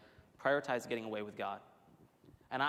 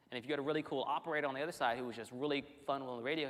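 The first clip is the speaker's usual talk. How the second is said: ordinary talk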